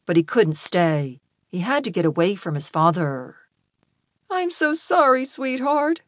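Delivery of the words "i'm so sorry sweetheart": high, sorrowful motherly voice; grieving mom, pitched well up; tearful mother. A narrator's reading. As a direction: apologise to your child through tears. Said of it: "His mother was crying now".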